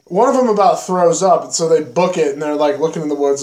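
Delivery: ordinary talk, nothing marked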